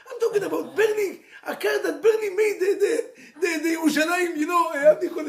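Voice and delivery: high, loud, laughing rush; excited laughing. Real, not acted. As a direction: burst out laughing as you tell it. Excited storyteller laughing at disaster.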